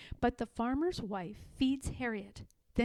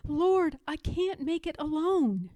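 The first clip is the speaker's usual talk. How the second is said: desperate plea; 6 semitones higher than usual. A narrator's reading. Fleeing through swamp, pleading God.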